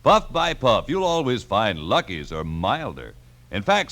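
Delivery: usual speaking voice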